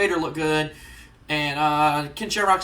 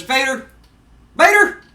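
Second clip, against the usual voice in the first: high, loud wrestling-promo call; calling out on mic; 7 semitones higher, 9 dB louder than usual. Acted. Jim Cornette calls Vader out from the ring, loud promo shout. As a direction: shout the name to summon him across the arena. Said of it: "he gets into the ring and he goes".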